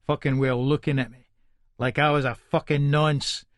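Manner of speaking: ordinary delivery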